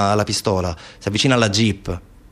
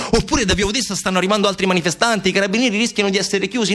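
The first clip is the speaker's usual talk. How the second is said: urgent narration; 8 semitones higher than usual. A narrator's reading. Narrator guessing why the carabinieri retreat, urgent and mounting. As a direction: pitch raised, pressing account with no let-up. Narrates escalating hypotheses of the charge.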